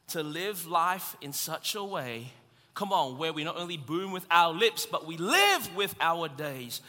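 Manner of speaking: normal talking voice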